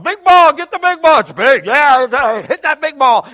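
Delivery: high, loud team yell; excited yelling. Retold or imitated. Teens yelling at each other as they charge the ball, high and loud. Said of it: "they all kept yelling".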